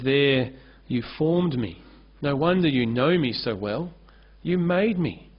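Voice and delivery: normal talking voice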